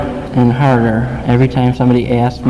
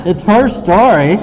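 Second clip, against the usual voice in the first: emotional; 7 semitones higher, 7 dB louder than usual. Real, not acted. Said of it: "why am I getting emotional".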